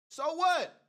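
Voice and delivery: loud high So what? shouted in defiance; defiant shout. Real, not acted. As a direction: throw it back at the doubters. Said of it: "repeated louder".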